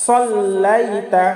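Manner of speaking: usual speaking voice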